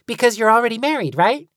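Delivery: put-on fast, loud, high teasing kid; teasing child. A narrator's reading. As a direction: tease him, rushing the words.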